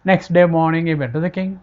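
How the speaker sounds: usual speaking voice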